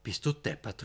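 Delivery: leicht empört aufgerufen; empörter Ausruf. Acted. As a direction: ein Hauch von Entrüstung. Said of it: "rief Josef". (Josef ruft Hakim leicht empört zu)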